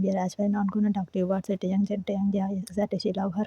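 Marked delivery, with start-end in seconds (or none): none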